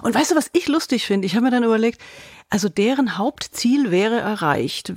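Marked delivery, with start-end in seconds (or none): none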